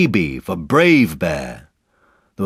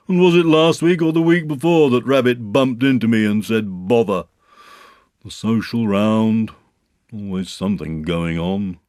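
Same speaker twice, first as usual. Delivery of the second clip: Eeyore; 5 semitones higher, 5 dB louder than usual. Acted. Eeyore consoling himself unheard, self-pitying. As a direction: complain while claiming not to.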